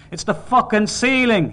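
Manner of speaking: high, loud burst of exasperation; furious husband's yell; angry shout. A narrator's reading. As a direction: shout it to break through denial. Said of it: "he shouted".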